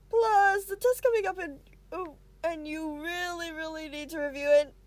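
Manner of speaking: loud, high nervous babble; nervous rambling. Acted. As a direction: ramble on in a flustered rush. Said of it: "He's rambling".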